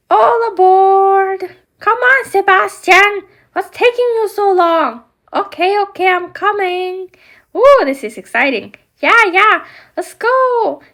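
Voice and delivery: high, loud eager toy voices; rally everyone aboard the boat; excited doll voices. Acted. Ariel calling everyone aboard for the adventure, high and excited.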